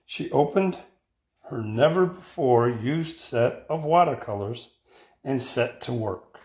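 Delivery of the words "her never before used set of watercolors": ordinary delivery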